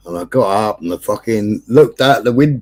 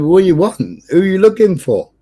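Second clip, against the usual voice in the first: called up from the window, higher and louder; calling out the window; 8 semitones higher, 4 dB louder than usual. Retold or imitated. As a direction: shout down at the police outside. Shouting out the window at police.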